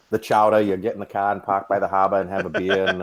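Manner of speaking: put-on Boston accent with dropped r's. Shown as a demonstration. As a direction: lay on the Massachusetts townie accent. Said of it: "I am very fluent in Bostonian".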